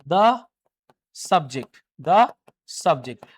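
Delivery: "The subject" with "The" said द, thuh; short "the" before a consonant. Shown as a demonstration. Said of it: "ये स से शुरू हो रहा है". ("The" को द बोलिए)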